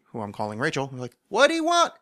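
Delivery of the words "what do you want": very high, called-out exasperated question; shouting across the street. Retold or imitated. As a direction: yell the question across the road. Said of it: "Lady across the street ... like".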